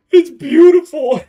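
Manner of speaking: high, loud, overwhelmed gush. Real, not acted. Streamer gushes at her ugly character, loud and high. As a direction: gush it out, half laughing. Gushing over hideous creation.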